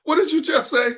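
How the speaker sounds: can't believe what you just heard; stunned disbelief. Real, not acted. Cohost hearing about the hand espresso, high stunned disbelief.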